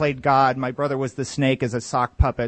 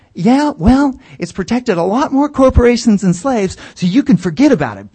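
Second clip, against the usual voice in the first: louder, higher taunt; mock gloating shout; 6 semitones higher, 7 dB louder than usual. Corporate neighbor gloating over corporations versus slaves, loud taunt. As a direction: lord it over him loudly. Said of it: "shouting match".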